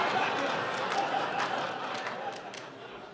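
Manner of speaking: high laugh with no voice; real laugh. Real, not acted. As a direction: crack up at your own joke. Laughs after his Crikey joke.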